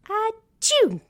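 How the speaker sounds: loud high sneeze; big sneeze. A narrator's reading. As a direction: let out a big comic sneeze. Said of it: "let out a big sneeze".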